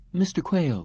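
put-on high, composed young woman; smooth receptionist brush-off. A narrator's reading. Receptionist voiced.

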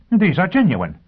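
high, loud burst of disbelief; blurted out. A narrator's reading. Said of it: "Sheffield blurted".

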